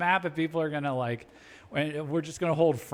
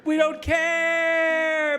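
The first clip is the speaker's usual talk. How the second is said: hammed-up American yell, much higher and louder, very slow; mock shout; 13 semitones higher, 5 dB louder, much slower than usual. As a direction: bellow it like a cartoon superpower. Mocking voice builds to shout.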